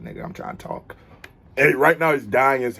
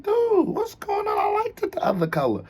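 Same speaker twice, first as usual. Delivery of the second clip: high, whimpering mock girlfriend plea; whiny girlfriend; 14 semitones higher than usual. Mocking the girlfriend upset about his hair, whiny and high. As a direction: whine it like she's about to cry. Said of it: "She like".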